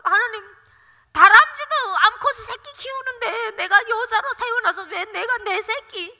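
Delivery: high, loud wail of complaint, drawn out; tearful angry protest. Retold or imitated. Mother protesting to God over her child, tearful anger. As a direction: plead bitterly, near tears. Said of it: "그러면서 막 울었습니다".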